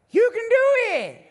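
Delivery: high loud Cajun yell, slow; Water Boy shout. Retold or imitated. Preacher quoting The Water Boy in a high loud yell.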